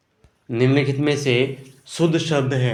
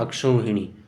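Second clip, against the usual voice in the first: usual speaking voice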